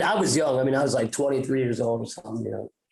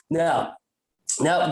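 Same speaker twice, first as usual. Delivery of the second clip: ordinary talk, nothing marked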